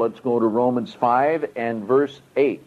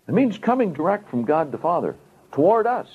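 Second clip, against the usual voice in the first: normal talking voice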